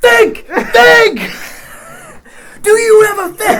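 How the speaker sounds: very loud high shout; shouting think. Retold or imitated. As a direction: scream it, cracking with laughter. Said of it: "I go".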